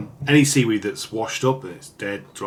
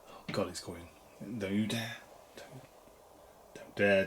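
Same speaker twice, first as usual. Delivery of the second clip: hushed warning; 8 dB quieter than usual. Real, not acted. Hushed threats to the pigeon.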